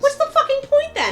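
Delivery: what is even the point, in disbelief; incredulous outburst at a pointless movie plot; exasperated disbelief. Real, not acted. Outraged reaction to a pointless plot.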